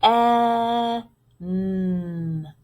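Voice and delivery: "E, n" as long isolated sounds; sounded out. Shown as a demonstration. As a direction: hold a short "eh", then hum "n". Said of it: "there's my w".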